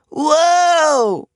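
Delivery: long, high, loud whoa; startled cry. A narrator's reading. Starla on the track, a loud startled whoa. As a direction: caught off guard by the spill. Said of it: "cried Starla".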